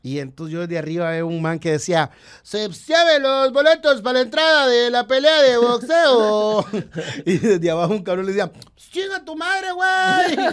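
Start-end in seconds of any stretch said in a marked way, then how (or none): 2.4-6.6 put-on slow, high, loud Mexico City street hawker accent; drawn-out chilango seller shouting his wares; chilango vendor call. Ticket seller calling out at the boxing match, chilango accent. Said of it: "ese hablado chilango". 8.8-10.5 much higher, put-on Mexico City chilango accent; a cocky chilango heckler, pitched way up; chilango heckle. Heckler shouting up at the ticket seller, chilango accent. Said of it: "ese hablado chilango".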